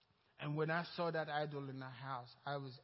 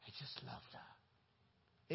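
hushed; 3 semitones lower, 9 dB quieter, much slower than usual. Real, not acted. Tender aside in testimony.

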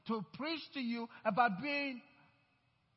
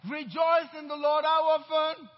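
preacher calling out; 4 semitones higher, 9 dB louder than usual. Acted.

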